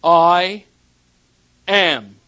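I ... am, thundered out with long pauses; booming proclamation. Acted. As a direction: proclaim it like God himself. Preacher booms God's name.

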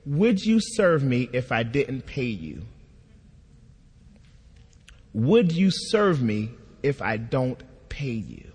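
slow, measured question with long pauses; lightly voiced God, calm and deliberate; clear voice of God. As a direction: ask it clearly and soberly, letting it land. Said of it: "I hear this voice say clearly".

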